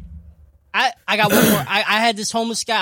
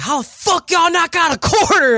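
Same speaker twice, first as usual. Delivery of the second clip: mock yell; 10 semitones higher, 8 dB louder than usual. Retelling a homeless man yelling.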